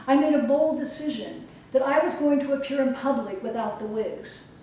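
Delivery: ordinary delivery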